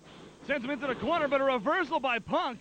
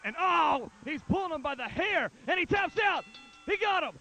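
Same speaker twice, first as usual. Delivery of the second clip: high, fast, rising call of the finish; excited play-by-play; 6 semitones higher than usual. Real, not acted. Submission win, commentator erupts.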